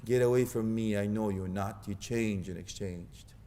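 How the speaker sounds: low hushed rebuke, slow at the end; grave low rebuke. Pretend. Preacher voices the rejection on Judgment Day, low and hushed. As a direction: drop low and hush the rejection. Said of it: "will they say".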